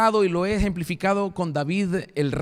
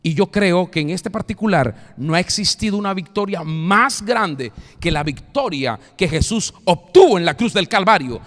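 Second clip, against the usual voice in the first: usual speaking voice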